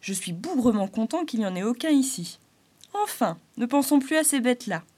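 Arlequin relieved. Acted. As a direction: dismiss humans lightly.

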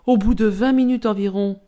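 ordinary delivery

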